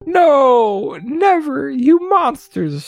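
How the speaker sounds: high-pitched acted protest; shrieky mock refusal, drawn out at the end; upset yell. A narrator's reading. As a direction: cry out in hammed-up outrage. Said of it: "Shelf yelled, very upset".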